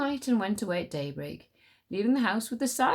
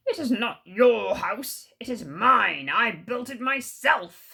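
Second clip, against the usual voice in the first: loud, slow insistence; angry tiger; 3 semitones higher, 6 dB louder, slower than usual. Acted. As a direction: insist hotly, slowly. Quarrel over house.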